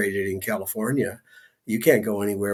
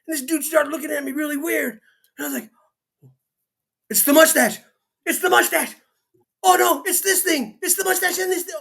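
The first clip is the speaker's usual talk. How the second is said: high-pitched jittery guy voice, much higher throughout; comic character voice; 13 semitones higher than usual. Acted. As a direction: play a nervous, excitable man rushing in. [name] arrives in character voice.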